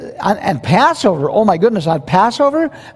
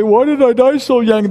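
mock complaint; 6 semitones higher than usual. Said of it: "aren't gonna say".

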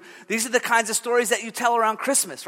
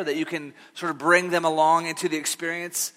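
ordinary delivery

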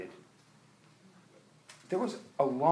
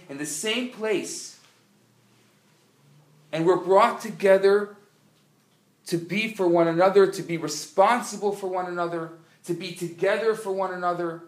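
louder, higher preaching build; building to a louder, higher call to act; rising sermon; 6 semitones higher, 8 dB louder than usual. Acted. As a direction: lift the room with fervour. Preacher rises to full force.

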